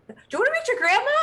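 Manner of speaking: high, loud excited squeal; excited offer. Acted. Mother offering her child a meeting with grandma, excited. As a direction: rush it out loud and high, bursting.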